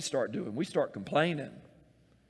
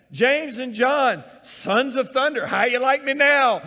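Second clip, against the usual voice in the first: loud, higher, playful taunting call across the water; teasing Jesus; 9 semitones higher, 11 dB louder than usual. Acted. Jesus teasing his disciples in the storm, loud playful call. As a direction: call out cheekily to the struggling fishermen.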